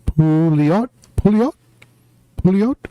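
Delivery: high rising question, trying out a name; unsure, high-pitched rising query; tentative questioning. Real, not acted. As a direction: sound it out high, checking the pronunciation. Unsure how to say viewer's name.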